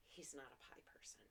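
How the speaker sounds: very soft whispered aside. Real, not acted. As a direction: say it under your breath, whispering. Aside about the co-host.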